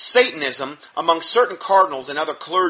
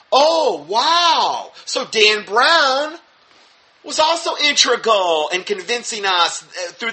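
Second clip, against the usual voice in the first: high, loud fake amazement; mock surprise; 6 semitones higher, 6 dB louder, slower than usual. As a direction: gasp in fake wonder.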